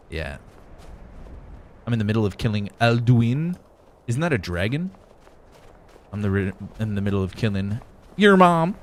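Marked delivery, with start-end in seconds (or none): none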